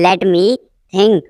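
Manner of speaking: teacher setting "think" apart; broken-up repeat. Shown as a demonstration. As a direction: pause before "think".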